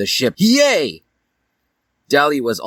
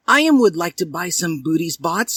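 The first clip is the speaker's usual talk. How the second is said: high, eager and bright with a smile; enthusiastic, smiling; 9 semitones higher than usual. Acted. Dally eager to buy sweets, smiling. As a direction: sound keen and smiling. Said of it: "enthusiastically and smiling".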